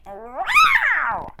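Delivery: high loud cat screech; shrieking alley-cat yowl; angry cat yowl. Acted. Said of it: "the cat was infuriated and went".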